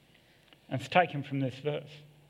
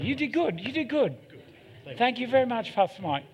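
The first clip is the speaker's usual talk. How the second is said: said much higher and brighter, 'good' falling; sing-song praise, pitched well up; playful high-pitched 'You did good'; 8 semitones higher than usual. Acted. Repeated praise to pastor, pitched up.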